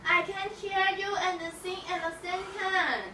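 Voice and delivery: normal talking voice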